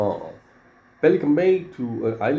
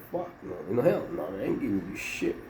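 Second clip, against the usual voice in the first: private refusal said under the breath, softer; inner refusal; 3 semitones lower, 7 dB quieter than usual. Retold or imitated. Thinking his answer to Diablo, muttered softly. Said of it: "in my mind".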